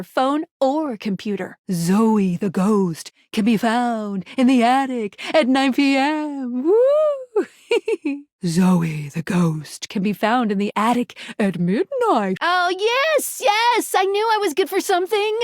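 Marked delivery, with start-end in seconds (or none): 1.7-6.6 slow, eerie ghost-story delivery; spooky ghost voice. Acted. 6.6-7.5 high wailing ghost "woo"; playful swooping ghost wail; ghostly woo. Acted. 8.5-12.4 low, eerie ghost-story delivery; haunt the listener with it; spooky ghost voice. Acted. 12.4-15.4 high, gleeful outburst; delighted. Acted. Delighted at being useful.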